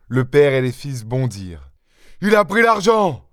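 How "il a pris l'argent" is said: high-pitched outraged cry; vociferating. A narrator's reading. Master rushing to the door after the thief, vociferating. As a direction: shout it out, pitch far above normal. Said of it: "vociféra maître Goussot".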